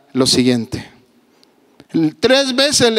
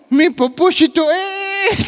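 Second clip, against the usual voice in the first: put-on dying old man; 12 semitones higher than usual.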